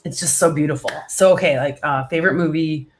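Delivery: ordinary talk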